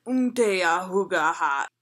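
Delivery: slow mock [name] chant; imitating [name]. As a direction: grunt it slowly like [name]. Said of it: "he does this thing that's like".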